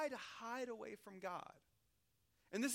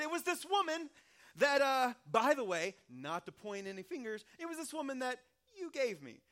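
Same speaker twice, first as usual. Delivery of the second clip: high, loud, defensive excuse; whiny blaming; 5 semitones higher, 7 dB louder than usual. Retold or imitated. Pastor plays Adam blaming the woman before God, high and loud. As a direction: point the finger, voice up and loud.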